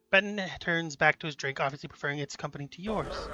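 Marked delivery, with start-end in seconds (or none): none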